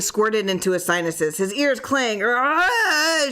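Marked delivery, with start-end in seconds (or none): 2.2-3.3 high loud cry of pain; shriek of agony. A narrator's reading. Man in agony shrieks as his head is crushed. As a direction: scream as the jaw bursts. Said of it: "shrieked Harris".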